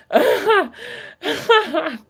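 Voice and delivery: high, long squealing laugh; helpless high-pitched laughter. Real, not acted. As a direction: crack up at being teased. Laughs at being teased by Will.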